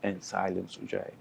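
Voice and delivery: quiet, soothing yoga instructor voice; soft guided breathing. Acted. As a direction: gently coax the breath, softly.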